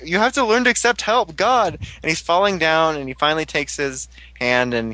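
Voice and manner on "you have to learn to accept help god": much higher, exasperated scolding; mock exasperated hero. Fan voicing the rescuer scolding the falling hero. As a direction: plead it with mock frustration. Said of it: "He's like".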